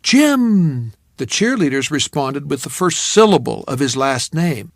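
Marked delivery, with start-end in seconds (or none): 0.0-0.9 crowd yell. Retold or imitated. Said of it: "Each time the crowd yelled".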